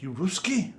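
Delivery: high, loud mock question; retold blurted question, high and loud; imitated boys' question.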